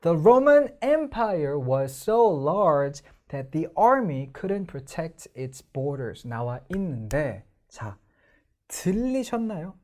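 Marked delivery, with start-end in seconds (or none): 0.0-6.3 very slow, each stressed syllable hit hard; slow teacher demo with heavy word stress; slow stressed reading. Shown as a demonstration. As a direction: read it out word by word, punching the stresses. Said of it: "강세를 이 성우가 지키면서 읽어주고".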